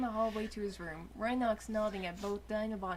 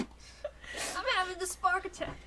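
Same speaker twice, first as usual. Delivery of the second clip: mock spark attack; 10 semitones higher, slower than usual. Mock fit.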